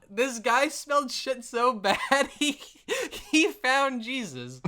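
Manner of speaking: slow high laughing delivery; fighting laughter through the punchline. Real, not acted. Narrator cracking up.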